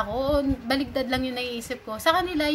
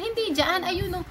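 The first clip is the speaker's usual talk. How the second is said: imitating Bisaya friend; 7 semitones higher than usual. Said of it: "iba yung tono nung boses niya".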